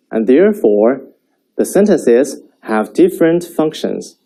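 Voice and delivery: ordinary delivery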